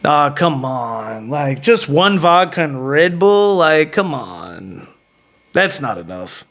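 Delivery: low, slow, drawn-out complaint; unimpressed haggling. Retold or imitated. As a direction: drag it out as if let down by the offer.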